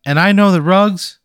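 high, loud, nervous local ad voice; nervous TV pitchman. Acted. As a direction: play an awkward local TV salesman. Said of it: "He says".

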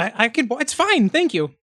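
high, halting kobold. Acted. Kobold answering the one who lifted it, high voice. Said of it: "The kobold says".

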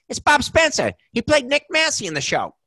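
very high, cartoonish impersonation; Joe Pesci. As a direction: pipe up like an excited cartoon character. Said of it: "literally like a cartoon character".

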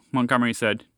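usual speaking voice

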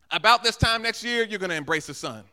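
loud proclamation that starts high; declaimed promise. Acted. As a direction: announce the promise boldly. Elisha's prophecy retold at full force.

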